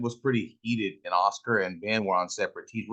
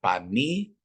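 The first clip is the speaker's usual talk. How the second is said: normal talking voice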